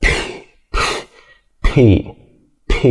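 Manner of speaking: "P" with a strong puff of air; aspirated P. Shown as a demonstration. Teacher showing learners the English sound, "P" released with a puff. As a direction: burst the lips open with air on each "P". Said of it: "في زي هوا بيطلع منك".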